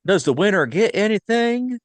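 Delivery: high, loud, eager question; chirpy high voice asking about the prize; eager Blanc impression. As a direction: pitch it way up like an excited kid at a party. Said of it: "Blanc's character's like".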